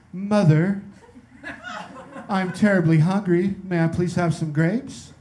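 a hint of an overly polite child asking; prim child voice, slowed on the grapes; well-spoken child. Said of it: "the most well-spoken kid".

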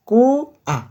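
কু held long and high, then a separate আ; two separate beats. Shown as a demonstration. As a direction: stretch কু high, break, then land on আ. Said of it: "দুইবার উচ্চারণ হয়েছে". (কু টেনে উঁচু স্বরে, তারপর আলাদা করে আ)

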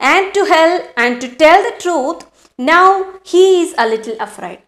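slow, deliberate reading of the story. A narrator's reading. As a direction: read each phrase slowly for the class. Teacher reads story aloud. (പതുക്കെയുള്ള കഥ വായന)

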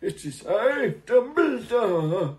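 high, slow, grand announcement; hammed-up slow Dumbledore; pompous wizard. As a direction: proclaim it like an ancient wizard. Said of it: "He just has to say".

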